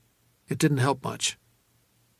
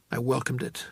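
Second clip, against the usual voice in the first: ordinary delivery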